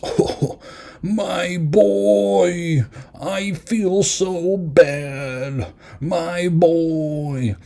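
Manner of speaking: high, very slow mawkish father voice; mocking Victor's tearful apology to his creation; mocking sappy Victor. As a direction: ham up a weepy dad's apology. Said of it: "Victor starts doing this".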